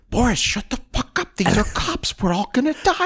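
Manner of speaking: high panicked hush; panicked plea. Retold or imitated. Lee panicking at his bodyguard, high frightened voice. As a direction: beg Boris to stop, terrified.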